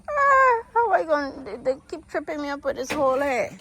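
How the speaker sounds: high, crying-edged complaint; exasperated high wail; frustrated wail. Real, not acted. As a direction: whine about the hole, near tears. Chicks keep digging tripping holes.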